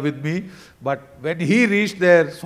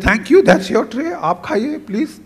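higher-pitched, polite protest; embarrassed; 6 semitones higher than usual. Retold or imitated. Traveller declines the boy's own tray, embarrassed. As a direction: hand it back with awkward thanks. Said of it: "he was embarrassed".

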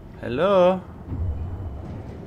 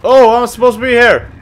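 excited; 8 semitones higher, 7 dB louder, faster than usual. Real, not acted. Sudden realization.

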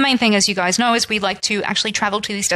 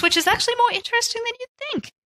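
high quick aside; 13 semitones higher, faster than usual. Real, not acted. Teasing aside about condiments.

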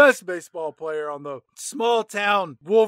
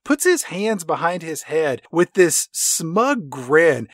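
normal talking voice

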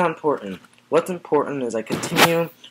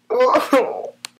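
high laugh; 12 semitones higher, 8 dB louder than usual. Acted. Laughs through fake tears.

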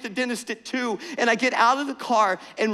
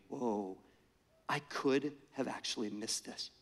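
a low, much softer "Whoa"; quiet stunned wonder; awed hush; 10 semitones lower, 14 dB quieter than usual. Real, not acted. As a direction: let the realization land in a hush. Realization of nearly missing moment.

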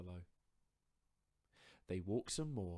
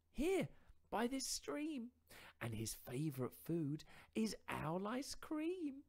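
higher, louder mouse voice, spinning a tall tale; boastful little mouse; 5 dB louder than usual. Acted. Mouse inventing a monster to frighten fox, higher and louder.

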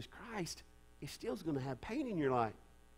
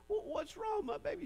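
much higher, tender mother's voice; mama comforting. Retold or imitated. Pastor voicing a mother asking her crying child, high and tender. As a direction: coo it like a worried mama. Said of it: "her mama says".

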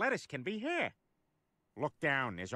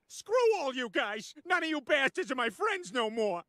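angry outburst; 6 semitones higher than usual. Acted. Roasted man disowns his friends, angry outburst. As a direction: lash out at the whole room.

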